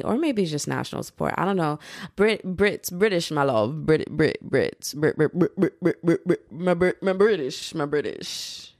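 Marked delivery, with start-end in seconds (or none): 2.2-8.7 a clumsy, lightly put-on British accent, stumbling over Brits; bad British accent. Teasing British listeners in a bad British accent. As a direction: fumble through a mock British accent. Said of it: "the accent that I just tried to do".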